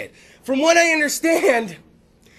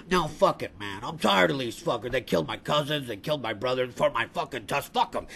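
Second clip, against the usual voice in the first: deep, quiet, fed-up elephant; Jumbo; 12 semitones lower, 9 dB quieter than usual. Acted. Elephant refuses to calm down for his buddies, deep quiet voice. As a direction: grumble very low, weary of the poachers. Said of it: "I imagine that's how he sounds".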